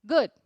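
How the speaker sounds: "Good" as one syllable; one-syllable English "Good" with a light d; soft final D. Shown as a demonstration. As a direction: end "Good" on a very soft d, no vowel after. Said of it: "bem suave e ele é uma única sílaba".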